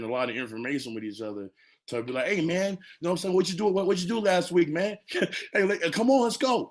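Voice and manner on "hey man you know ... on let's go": high, loud, fast imitation of pals; rally a buddy, hyped up; excited mock greeting, pitched way up; eager buddy chatter. Voicing the group's eager chat.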